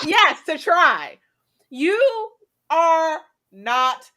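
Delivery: each word slowly stressed; slow emphasis. Real, not acted. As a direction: lean hard into every word. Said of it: "slow emphatic scolding".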